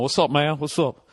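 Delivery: lightly voiced [name] impersonator; a hint of [name] in the greeting; half-hearted [name] act; [name] impression. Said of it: "Like, trying to be [name]".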